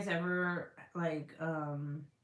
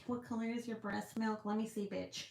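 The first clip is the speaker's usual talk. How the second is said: mock expert; 4 semitones higher, 4 dB quieter than usual. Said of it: "I'm like".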